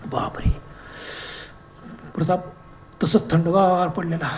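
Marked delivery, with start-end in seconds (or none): 0.0-0.6 breathless gasped whisper of dread; horrified whisper. A narrator's reading. Narrator whispering in horror at the death. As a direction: whisper it in shock.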